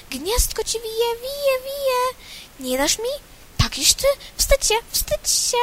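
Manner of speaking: high, chattering bird voices; squabbling birds. Acted. All the birds squabbling over twigs, high and shrill-free chatter. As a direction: bicker like a flock grabbing at scraps. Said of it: "wszystkie ptaki. W szczebiot, w świergot, w zgiełk".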